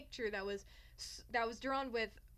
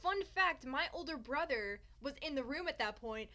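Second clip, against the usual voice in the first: usual speaking voice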